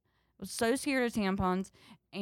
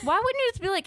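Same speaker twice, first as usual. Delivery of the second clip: higher and louder, fed up; exasperated outburst; 13 semitones higher, 6 dB louder, faster than usual. Retold or imitated. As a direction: throw it out in disbelief. Exasperated retort at mom.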